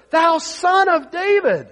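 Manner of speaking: high, slow sustained call. Acted. Blind man's faith making him call to Jesus, crying out. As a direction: draw the cry out high. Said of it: "cry out even louder".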